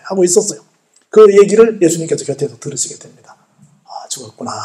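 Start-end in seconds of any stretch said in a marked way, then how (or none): 3.8-4.5 quiet, near-whispered realization; hushed quote. Retold or imitated. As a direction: drop low, almost to a whisper. Overhearing news of the death. (속삭이듯 낮게)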